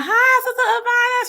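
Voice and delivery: high, loud, bright hello; excited high greeting. Real, not acted. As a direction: call out a delighted hi. Greeting a chat member.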